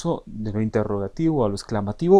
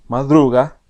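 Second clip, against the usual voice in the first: madruga said loud; tonema demo; 2 semitones higher, 7 dB louder, slower than usual. Shown as a demonstration. Teacher repeats tonema, loud madruga. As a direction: press madruga with force.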